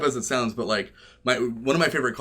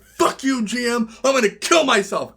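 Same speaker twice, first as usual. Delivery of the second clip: high, loud tantrum; hammed-up furious outburst; mock rage; 11 semitones higher, 5 dB louder than usual. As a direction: imitate a banned player blowing up. Banned player raging.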